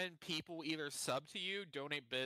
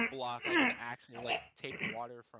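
crying-like laughing; 6 semitones lower, 4 dB louder than usual. Real, not acted. Streamer after aspirating, laughing till he sounds like crying. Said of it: "sounds like he's crying".

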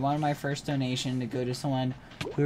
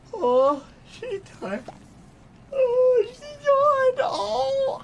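very high squeaky cooing; tiny high-pitched gushing; adoring coo; 5 dB louder, much slower than usual. Real, not acted. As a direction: melt over a sleepy puppy. Cooing over yawning dog.

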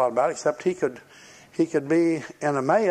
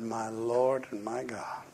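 awed hush; 4 semitones lower, 8 dB quieter, slower than usual. Retold or imitated. Thomas's awed confession.

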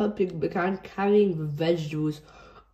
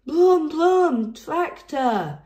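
much higher, louder shout; shouted tractor noise; 9 semitones higher, 5 dB louder, much slower than usual. A narrator's reading. George shouting at the big tractors, excited. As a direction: yell it at the tractors. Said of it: "he shouted".

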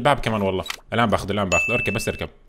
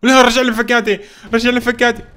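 loud, high-pitched wail of protest; distressed shout; 10 semitones higher, 7 dB louder than usual. Real, not acted. Player demanding his lost screwdrivers back, distressed shout. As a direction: yell desperately for it back. Losing loot after server kick.

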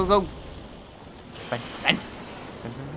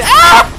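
very high, loud scream; panicked scream; 15 dB louder than usual. Real, not acted. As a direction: let out a shriek of alarm. Danger strikes.

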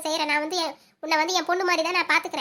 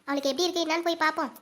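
ordinary delivery

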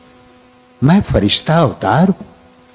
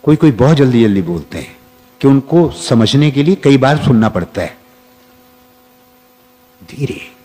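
ordinary talk

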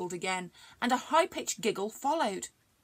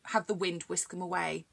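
ordinary delivery

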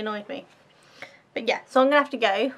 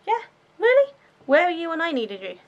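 sarcastic disbelief; 7 semitones higher, 6 dB louder than usual. Real, not acted. Sarcastic retort to Facebook promises.

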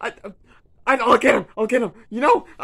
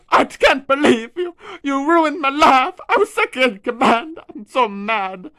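higher, louder complaint; whiny rant; 6 semitones higher, 5 dB louder than usual. Acted. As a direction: wail it indignantly. Donkey's son furious.

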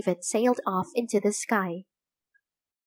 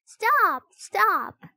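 crying out; 11 semitones higher than usual. A narrator's reading. Annie begs the grown-ups to stop: high frantic cry. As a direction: stop them before they hurt the birds. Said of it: "cried Annie".

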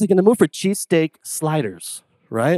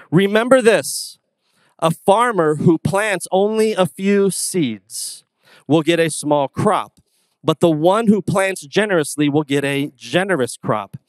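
slow, higher solemn Bible reading; scripture reading; 4 semitones higher, 3 dB louder, slower than usual. Acted. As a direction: read the verse slowly to the congregation. Reads scripture aloud.

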